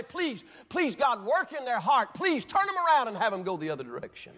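high, fervent plea to God; pleading prayer. Acted. Believer praying for family before God, pleading. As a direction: beg on behalf of a wayward loved one. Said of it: "Keep holding them up before God and say".

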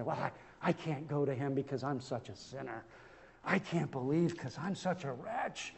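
low, soft mock self-reproach; downcast sinner. Preacher imitating a sinner who feels too unworthy, downcast. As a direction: sink into a subdued, defeated voice.

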